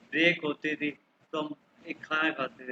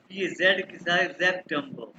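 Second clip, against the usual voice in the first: normal talking voice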